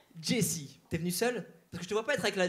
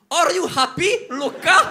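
higher, louder English-teacher voice; mock English teacher; 7 semitones higher, 6 dB louder than usual. Comedian playing an English teacher to a boy, mock-bright. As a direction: cheer it like a classroom teacher. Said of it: "Je vais passer pour une prof d'anglais".

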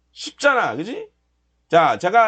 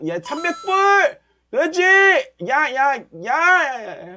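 root for the candle like a fan; cheering the market on; 6 semitones higher, slower than usual. Real, not acted. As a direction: trader yelling at his chart to climb. Cheering profits rising.

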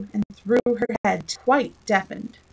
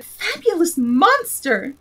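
cried out; 8 semitones higher, 4 dB louder, slower than usual. A narrator's reading. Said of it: "the unicorn cried out".

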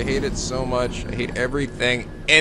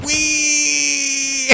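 long, high squeal; drawn-out high whee; gleeful whee; 12 semitones higher than usual. Real, not acted. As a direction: squeal it out on a victory roll. Mock celebration of victory roll.

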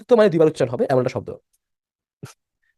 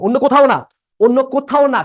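much higher and louder, insistent; emphatic insistence; 8 semitones higher, 6 dB louder than usual. Real, not acted. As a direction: drive the restriction home loudly. Teacher hammering home the rule's limit.

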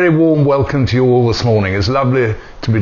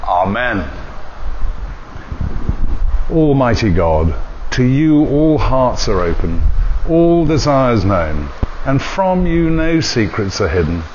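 liturgical prayer. Real, not acted. Leads liturgy prayers in service.

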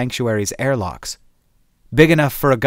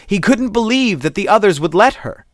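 panicky; 9 semitones higher than usual. A narrator's reading. Narration rising with panic.

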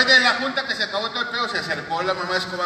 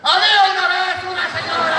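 much higher and louder; raised tone; 9 semitones higher, 6 dB louder than usual. Shown as a demonstration. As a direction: crank the pitch way up. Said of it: "quieren que le vaya subiendo de tono". (tono mucho más alto y fuerte)

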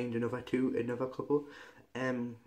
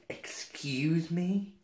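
higher, drawn-out indignant imitation; mild mock shout; 5 semitones higher, much slower than usual. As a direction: mimic an affronted server without real volume. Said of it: "started shouting at me grandma".

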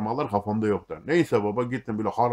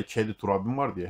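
normal talking voice

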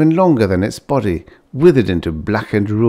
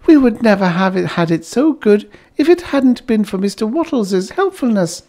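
high, louder delighted wife; a wife thrilled with her new home; gushing Bliss; 14 semitones higher, 3 dB louder than usual. A narrator's reading. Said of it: "reminded Bliss".

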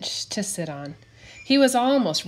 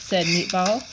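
ordinary delivery

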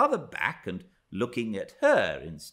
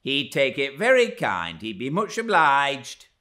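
raised, louder entreaty; forthright, higher-pitched plea; calling out; 5 semitones higher, 7 dB louder than usual. A narrator's reading. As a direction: speak up on his behalf. Relaying the secret request aloud.

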